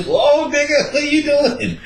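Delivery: high startled voice; mock startled woman. Mock woman pushing away the creep, high startled voice. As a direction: recoil in a high voice.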